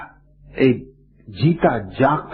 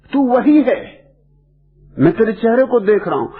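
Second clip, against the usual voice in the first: acted father insisting, pitched well up; retold fatherly indignation, high-pitched; indignant insistence; 8 semitones higher than usual. Angry father contradicting his son.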